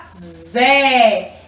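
ZÉ drawn out on a long vowel; teacher sounding out a long "Zé"; syllable sound demo. Shown as a demonstration. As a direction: buzz the Z, then hold the É in "Zé". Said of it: "é a sílaba".

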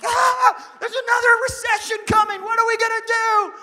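high, loud mock panicked shout. Churchgoers panic about a recession, high mock shout. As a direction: run around like a headless chicken. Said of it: "We're like".

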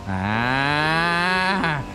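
ordinary talk

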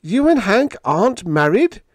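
high, loud, amazed question; incredulous. Acted. Biggs learning Helen is unmarried, incredulous. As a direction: ask in excited disbelief. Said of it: "he stared at Helen McDowell incredulously".

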